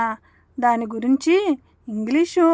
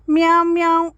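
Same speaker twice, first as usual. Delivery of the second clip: higher, louder mew; kitten meow; 4 semitones higher, 4 dB louder, faster than usual. Acted. Kitten asking Sharada for milk, louder meow.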